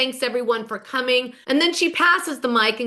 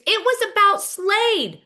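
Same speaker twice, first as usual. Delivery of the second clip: imitated scream; 9 semitones higher, slower than usual. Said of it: "Gretchen screams".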